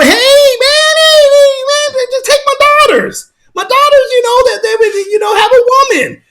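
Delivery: high, pleading, near crying; Lot pleading. Retold or imitated. Lot begs the mob at his door, high and tearful. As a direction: beg desperately in a thin high voice. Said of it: "And Lot was like".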